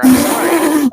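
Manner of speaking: overdramatic nurse. Acted.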